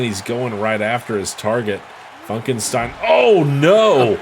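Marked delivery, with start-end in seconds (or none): 3.1-4.2 sudden high, loud "oh no"; surprised shout. Real, not acted. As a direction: gasp it out in shock. Sudden move at the steps.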